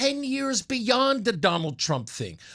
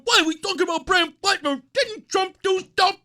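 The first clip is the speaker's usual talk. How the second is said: put-on higher, fast whining like a stomping child; whiny child; 8 semitones higher than usual. Mocking a critic as a whining child, higher and fast. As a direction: whine it like a kid in a tantrum. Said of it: "You're stomping around just going".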